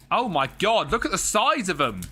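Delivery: high, loud "oh my god"; high loud shocked outburst; shocked excitement. Real, not acted. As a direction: gasp at how big they are. Shocked by wax strip size.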